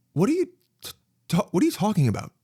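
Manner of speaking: high-pitched baffled question; confused. Retold or imitated. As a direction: act out bewilderment, voice jumping up. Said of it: "so confused".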